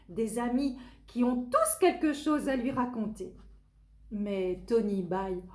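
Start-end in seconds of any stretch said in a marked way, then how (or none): none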